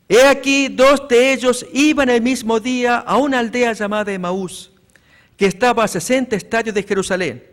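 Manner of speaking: loud, solemn Bible reading; scripture reading. Acted. Preacher reads Luke to the church, loud and solemn. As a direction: proclaim the text to the congregation.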